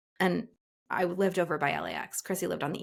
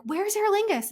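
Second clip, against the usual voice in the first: frantic asking; 12 semitones higher, 4 dB louder than usual. Retold or imitated. Asking someone while running.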